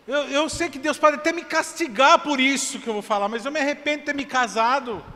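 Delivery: retold congregant breaking down, rising to a cry; desperate outburst. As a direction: pitch climbing to a desperate shout. Desperate congregant pouring out to pastor.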